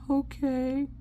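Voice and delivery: put-on high, small kid voice; meek imitation of a kid giving in; reluctant child.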